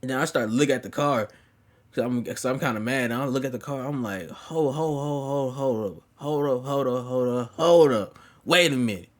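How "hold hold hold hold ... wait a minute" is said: growing alarm. Retold or imitated. Acted alarm on recognising the car.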